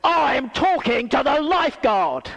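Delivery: loud, high, crisp correction; pointed put-down. Retold or imitated. Swimming lady tells the preacher she meant the lifeguard, loud pointed put-down. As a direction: put him firmly in his place. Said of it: "she went".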